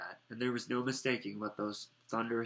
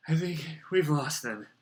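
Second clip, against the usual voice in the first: slightly raised, out-of-breath voice; panting; 4 semitones higher, 5 dB louder than usual. A narrator's reading. Harry, relieved to have lost their pursuers, panting. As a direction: say it as if panting after a chase. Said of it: "Harry panted".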